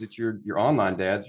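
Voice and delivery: normal talking voice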